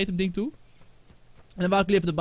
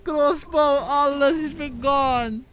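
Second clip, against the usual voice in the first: wailing lament; 8 semitones higher, 4 dB louder, much slower than usual. Real, not acted. Lost all items, lamenting.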